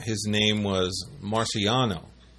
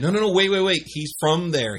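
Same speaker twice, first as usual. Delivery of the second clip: hurried explaining; 8 semitones higher than usual. Retold or imitated.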